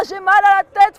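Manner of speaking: loud, grumbling king complaining; the king moans about his headache; grumpy king. Acted. The king complains to his guard, loud and grumpy.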